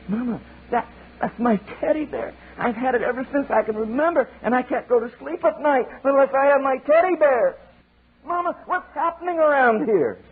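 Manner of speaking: high, whining little eaglet voice; squeaky baby bird complaining; whiny child eaglet. Acted. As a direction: plead like a small child. Eaglet pleading with mama.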